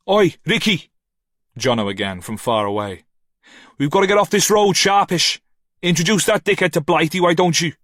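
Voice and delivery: loud, high shout across the distance; shouting from afar. A narrator's reading. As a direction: hurry him along from across the road. Jono shouts from far away.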